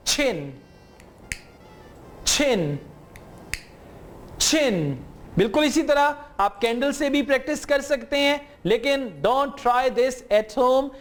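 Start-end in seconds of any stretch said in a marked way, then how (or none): 0.1-5.1 छिन with a strong puff of air on the ch; aspirated ch. Shown as a demonstration. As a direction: blow out the flame on the छ of छिन. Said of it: "गौर से देखिएगा". (छिन को ज़ोरदार हवा के साथ)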